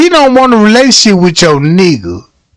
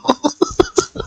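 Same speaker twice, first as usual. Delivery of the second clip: high squealing laugh; 9 dB quieter than usual. Real, not acted. Laughing at own story.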